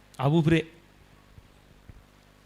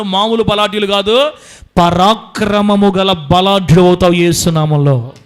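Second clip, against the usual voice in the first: loud, slow divine promise; God promising strength, loud and slow; 7 dB louder than usual. Acted. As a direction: proclaim as God to his children. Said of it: "దేవుడు చెప్తున్నాడు".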